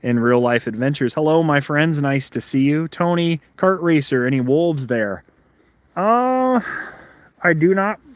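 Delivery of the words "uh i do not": muffled by a glove; talking through a glove. Real, not acted. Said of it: "Sorry, I'm muffled 'cause I'm putting my glove on".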